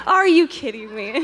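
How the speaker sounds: can't believe her luck; finding Starbucks beside Vitamin World, amazed; amazed disbelief. Retold or imitated. Amazed finding both shops.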